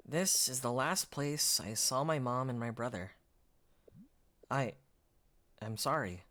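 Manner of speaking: mild understated sadness, voiced lightly; grieving young man. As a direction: let it sink low and tired, holding back tears. Voices the game's grieving hero.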